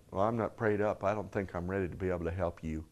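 soft, low self-doubting excuse; imitating a sheepish reluctant helper; ashamed introspection. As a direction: shrink back, quietly owning up. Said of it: "full introspection and, and, and shame and going".

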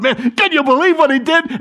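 high, breathless amazement; excited disbelief. Retold or imitated. Disciple running down the road, thrilled. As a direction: gush with excitement.

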